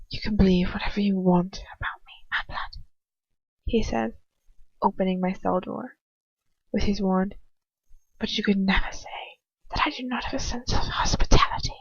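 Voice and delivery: mocking whisper. Lucius whispers mockingly as he leaves her cell. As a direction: whisper it like a cruel joke.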